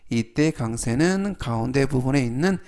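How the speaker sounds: ordinary talk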